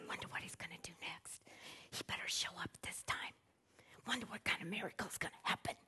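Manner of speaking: whisper. Retold or imitated. Crowd eyeing each other, gossiping.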